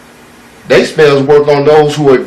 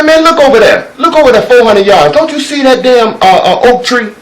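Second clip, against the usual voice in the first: eager urging; 8 semitones higher, 3 dB louder than usual. Acted. Old eagle urging him to look.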